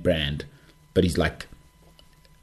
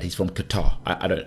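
ordinary delivery

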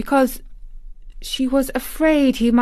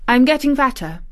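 put-on stiff little girl, higher pitched; 5 semitones higher, 4 dB louder, faster than usual. A narrator's reading. Mary answering her guardian, stiff. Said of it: "in what she knew was her stiffest way".

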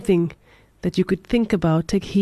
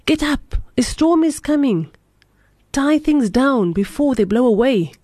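understated howl, raised in pitch; urgent higher-pitched alarm, only lightly acted; howled warning; 6 semitones higher than usual. A narrator's reading. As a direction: rouse a sleeper with a storyteller's mild cry. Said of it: "He shook the little man and howled".

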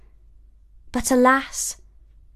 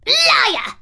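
high, loud shrieking queen; furious screech; 4 dB louder than usual. A narrator's reading. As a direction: shriek it in pure rage. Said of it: "screeched the queen in her rage".

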